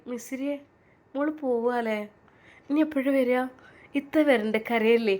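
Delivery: a sister seeing her off through tears; crying goodbye. Acted. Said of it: "Faseela കരഞ്ഞുപോയി". (കരഞ്ഞുകൊണ്ട് യാത്ര പറയുന്നു)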